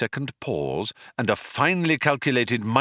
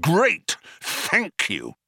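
curt, exasperated thanks; snapping; 9 semitones higher, much slower than usual. A narrator's reading. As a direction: snap out mock gratitude. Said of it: "snapped Zaphod".

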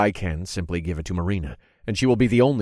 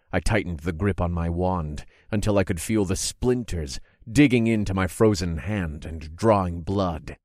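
plain reading voice

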